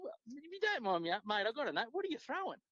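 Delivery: high-pitched, acting out a mate's question; retold curious question. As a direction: put the retold question up high, prying but friendly. Retold question to fellow angler.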